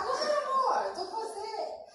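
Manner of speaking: high, excited mock husband's encouragement; imagined cheering husband. Wife imagining husband urging her on, high and excited. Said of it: "achei que ele ia falar".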